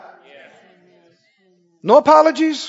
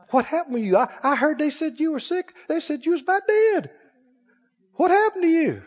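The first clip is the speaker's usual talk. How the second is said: high, softer astonished questioning; amazed questioner; 5 semitones higher, 5 dB quieter than usual. Acted. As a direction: act out a stunned acquaintance.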